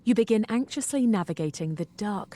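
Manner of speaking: usual speaking voice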